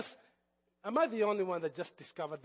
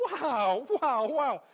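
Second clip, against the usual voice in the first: loud, higher disbelieving wow; stunned wow; 7 semitones higher, 9 dB louder, much slower than usual. Retold or imitated. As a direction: shout out your disbelief. Reacting to three-day wait.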